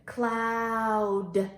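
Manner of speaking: CLOUD drawn out with a released D; long vowel in Cloud, then a separate D; word modelled. Shown as a demonstration. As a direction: lead a slow, clear Cloud for children to copy. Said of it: "let's say it together".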